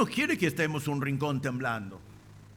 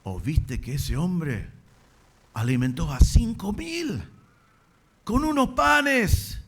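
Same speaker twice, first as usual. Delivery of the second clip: amazed exclamation; 3 semitones higher, 6 dB louder, slower than usual. Acted. Preacher voicing an awed witness to the miracle, amazed shout. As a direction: marvel at it louder and slower, word by word. Acts what the crowd should have marvelled at.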